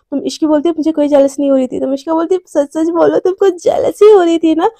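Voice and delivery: ordinary delivery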